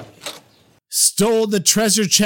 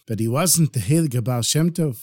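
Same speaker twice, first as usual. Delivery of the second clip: ordinary delivery, nothing marked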